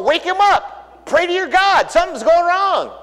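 high, frantic cries of sailors in a storm; panicked sailors. Retold or imitated. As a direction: shout it like sailors sure they're sinking.

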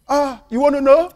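teasing, loud jab about a goat; hammed-up rude boss; mock blunt jibe. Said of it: "He didn't say it like that".

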